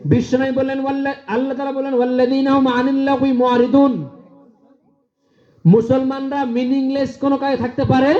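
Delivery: high, ringing preacher's cry; fiery preaching. Acted. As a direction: preach it out to the crowd. Preaching at full force.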